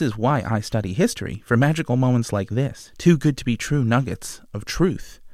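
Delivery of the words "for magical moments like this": ordinary talk, nothing marked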